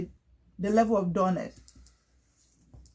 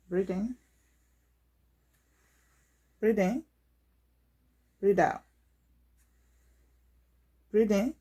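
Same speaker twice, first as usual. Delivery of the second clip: louder, paced breathing cues to patient; coaching breaths; 4 dB louder than usual. Real, not acted. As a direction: call each breath in and out steadily.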